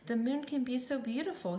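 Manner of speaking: ordinary delivery, nothing marked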